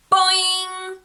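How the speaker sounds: high, loud springy boing; boing sound. Acted. Narrator voicing Nina's spring as he pushes her, loud boing. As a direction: sing out the spring.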